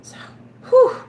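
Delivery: ordinary talk, nothing marked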